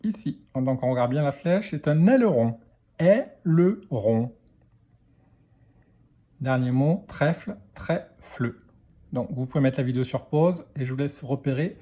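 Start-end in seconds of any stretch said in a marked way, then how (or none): 3.0-4.3 slow "Aileron", split into è, le, ron; syllable by syllable. Shown as a demonstration. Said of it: "dans quelle syllabe". 7.8-8.5 "trèfle" split into trè, fle; "trèfle" in two beats; syllable by syllable. Shown as a demonstration. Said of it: "dans quelle syllabe".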